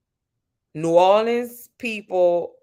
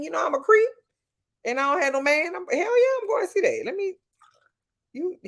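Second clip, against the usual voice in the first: high, squealing hell yeah; gleeful about shameless ogling; giddy creep; 13 semitones higher than usual. Real, not acted. Telling her chat she'll watch the sweaty men, squealing.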